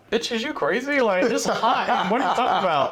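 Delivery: high-pitched mock outrage, half laughing; incredulous retort. New student balking at teacher's no-water rule, mock incredulous. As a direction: fire back, scandalised but amused.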